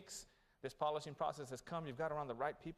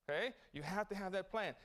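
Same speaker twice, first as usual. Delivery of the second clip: ordinary talk, nothing marked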